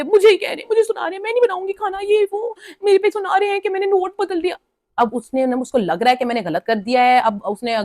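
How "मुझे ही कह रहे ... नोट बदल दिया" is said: much higher, hammed-up indignant housemate; mock offended outburst. Tania refusing to make rotis, storming off in a high, mock-offended outburst. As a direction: play her taking it personally and storming out. Tania complaining she's being targeted.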